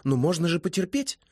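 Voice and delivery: put-on high, lively girlish character voice; high-voiced, sociable young mermaid; chatty young woman.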